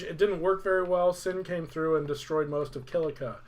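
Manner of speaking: usual speaking voice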